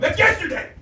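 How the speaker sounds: loud, high exclamation; mock outrage. Comic mocking Beijing's age, loud mock outrage. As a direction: blurt "That's yesterday!" loud and high.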